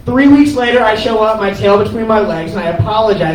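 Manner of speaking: ordinary talk, nothing marked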